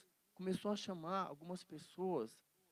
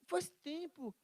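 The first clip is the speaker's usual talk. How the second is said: tearful Jesus; 9 semitones higher, slower than usual. Acted. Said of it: "Jesus fala".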